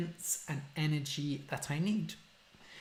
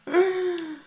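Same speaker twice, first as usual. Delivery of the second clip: excited gasp; 14 semitones higher, 4 dB louder than usual. Real, not acted. After revealing cut hair.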